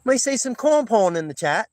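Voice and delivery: high, playful mock English accent. Shown as a demonstration. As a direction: ham up the English accent for the chat. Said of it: "English accent, uh, impersonation".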